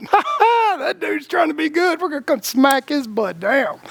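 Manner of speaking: gleeful villain taunting his target; evil forces of the world sneering at a good man, cackling; evil forces mocking. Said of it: "the evil forces of the world go".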